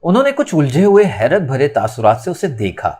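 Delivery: usual speaking voice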